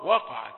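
"وقعت" with dark heavy wa; thick waw. Shown as a demonstration. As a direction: thicken the first syllable of "وقعت". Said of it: "تخينة". (تفخيم الواو في "وقعت")

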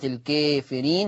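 الكافرين with the "aa" tilted toward "ee"; fronted long first vowel in الكافرين; taqlil. Shown as a demonstration. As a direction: say الكافرين as "al-kaefireen". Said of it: "فهي مقللة قولا واحدا".

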